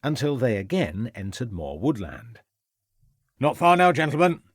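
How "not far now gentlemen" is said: called out loud and high; a lightly voiced shout ahead. A narrator's reading. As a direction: raise the voice to the searchers. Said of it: "shouted Rivers".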